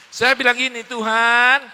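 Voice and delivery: loud, building preacher's voice; rousing sermon delivery; full-force preaching. Acted. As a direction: proclaim it to the hall.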